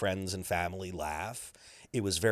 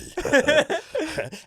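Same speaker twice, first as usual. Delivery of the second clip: duck laugh; 6 dB louder than usual. Acted. Guest laughs in character.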